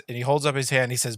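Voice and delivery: ordinary talk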